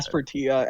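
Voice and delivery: Aspertia with a hard t, ending "tee-a"; four-syllable Aspertia, no "sh"; trailer's "as-per-TEE-a". Shown as a demonstration. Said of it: "the trailer pronounced it".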